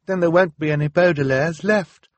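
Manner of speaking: high, loud distress; upset, very high and insistent; tearful. A narrator's reading. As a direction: build to a despairing cry, slowing at the end. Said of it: "Violet said tearfully".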